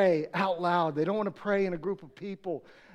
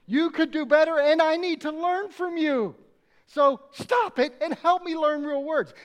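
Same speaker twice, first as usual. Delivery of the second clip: louder, lightly voiced baby talking; a hint of a wise baby speaking up; granddaughter's imagined voice; 5 semitones higher, 4 dB louder than usual. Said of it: "she's probably thinking".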